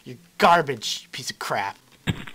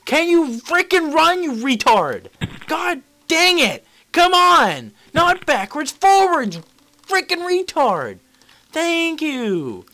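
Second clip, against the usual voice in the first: high-pitched rant at the game; exasperated yelling at the screen; frustrated rant; 7 semitones higher than usual. Real, not acted. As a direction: berate the runner. Raging at the game character.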